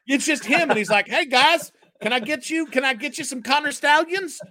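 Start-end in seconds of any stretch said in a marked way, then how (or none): 1.1-4.4 imagined Uncle T. Retold or imitated. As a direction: play the rich booster, high and pushy. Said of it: "That's how he talks in my head".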